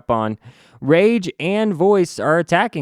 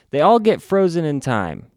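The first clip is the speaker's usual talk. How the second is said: ordinary talk, nothing marked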